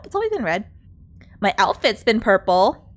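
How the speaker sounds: usual speaking voice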